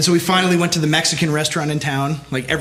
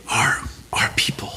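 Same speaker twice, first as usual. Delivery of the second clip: whispered dying breath, much softer and lower; dying gasp; 6 semitones lower, 5 dB quieter than usual. Retold or imitated. Quoting Boromir's last words in a dying whisper. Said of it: "In his, like, dying gasps".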